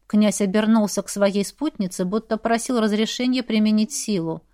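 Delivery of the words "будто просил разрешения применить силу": usual speaking voice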